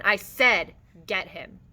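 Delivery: loud, insistent order; yelled. A narrator's reading. George ordering Lenny to fight, loudly insistent. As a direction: hit the opening hard, insisting. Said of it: "George yelled again".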